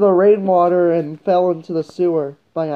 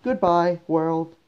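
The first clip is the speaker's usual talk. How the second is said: soft, fading goodbye; resigned farewell; 3 semitones lower, 8 dB quieter, slower than usual. Acted. Kid saying he's dead, soft resigned farewell. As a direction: let it sink away softly, lower at the end.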